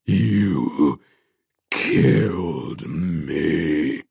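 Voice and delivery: low, very slow ghostly rasp; deep, drawn-out rasping dead man. A narrator's reading. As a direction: drag the accusation out in a rasp. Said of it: "the rasp from his throat grew louder".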